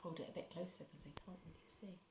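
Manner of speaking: whispered aside. Real, not acted. Parent whispering instructions to child mid-reading. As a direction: whisper it so the camera misses it.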